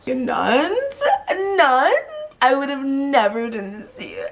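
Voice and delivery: high, crying mock wail of betrayal; imitating a crying wail. Distraught friend crying at her partner, mock wail. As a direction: wail it like a hurt friend sobbing.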